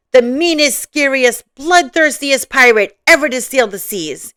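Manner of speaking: playfully menacing, voice lifted; boastful pirate cry. Acted. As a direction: proclaim it as the scariest pirate afloat. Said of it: "cried Papa".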